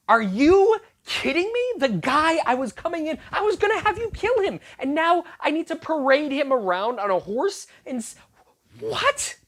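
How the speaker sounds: Haman can't believe his ears, voice shooting up; outraged disbelief. Retold or imitated. Preacher voicing Haman, stunned at the king's order, incredulous.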